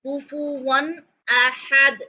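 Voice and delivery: drawn-out chanted recitation; long even melodic vowels; slow Quranic recitation. Shown as a demonstration. Teacher reciting the verse slowly. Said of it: "recitation demo".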